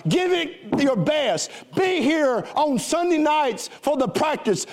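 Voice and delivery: rally the crowd toward victory; preaching at full force. Acted. Pastor urges the choir to sing with belief, preaching hard.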